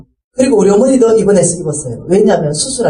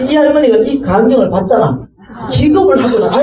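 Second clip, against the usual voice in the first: usual speaking voice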